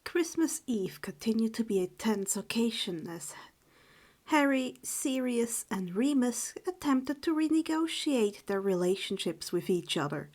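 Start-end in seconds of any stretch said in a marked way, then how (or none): none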